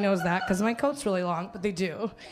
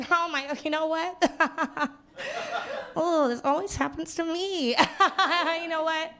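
flustered housewife; 4 semitones higher, slower than usual. Retold or imitated. Housewife in blindfold voiced.

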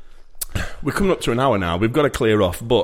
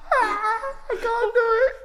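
laughing. Real, not acted. Collapsing into laughter.

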